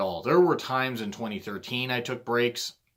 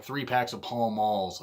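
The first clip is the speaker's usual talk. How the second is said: normal talking voice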